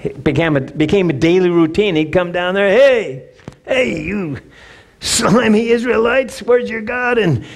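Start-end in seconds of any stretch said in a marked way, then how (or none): 2.7-7.2 higher mock taunting giant; giant's taunt. Goliath mocking Israel's army, hammed-up taunt. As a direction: sneer up at the Israelites, lightly. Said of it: "he's taunting them".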